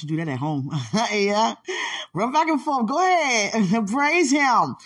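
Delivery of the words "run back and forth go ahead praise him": high, loud hype with laughs; cheering on. Pretend. Urging the man at the altar to praise, high and laughing. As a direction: egg him on like a cheerleader.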